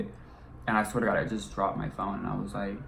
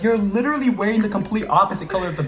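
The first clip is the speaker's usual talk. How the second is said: exasperated rant; 10 semitones higher, 7 dB louder, faster than usual. Retold or imitated. Mocking friend's hiding plan.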